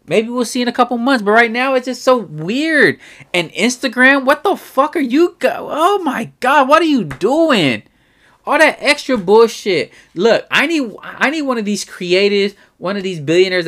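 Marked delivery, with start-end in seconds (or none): 3.3-9.9 pitched-up disbelief, stretching the last question; incredulous complaint rising high; exasperated rant. Real, not acted. Ranting about Instagram features.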